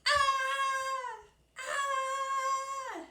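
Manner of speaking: two loud high screams; yelling. Retold or imitated. Scared brother yelling under the skeleton, loud screams. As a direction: scream out in panic. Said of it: "he just started yelling".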